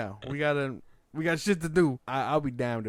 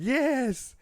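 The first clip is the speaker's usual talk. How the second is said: mock high yes; 12 semitones higher, 4 dB louder than usual. Retelling Steve Harvey show moment.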